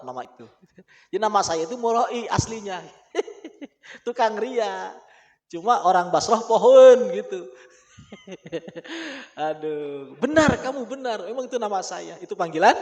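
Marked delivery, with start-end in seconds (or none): none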